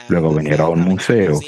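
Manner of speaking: usual speaking voice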